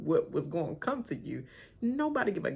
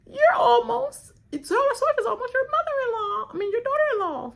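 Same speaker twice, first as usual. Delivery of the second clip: much higher, louder taunting voice; mock gossip crowd; 8 dB louder than usual. Everybody teasing [name] about [name], in a high mock voice. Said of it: "Everybody gonna be like".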